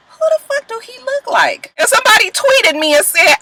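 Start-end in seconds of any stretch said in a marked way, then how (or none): 0.1-1.7 much higher, gleeful disbelief; incredulous squeal. Real, not acted. Mocking the pastor's looks, high and incredulous.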